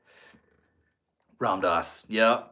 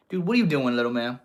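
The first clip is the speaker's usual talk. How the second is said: ordinary talk, nothing marked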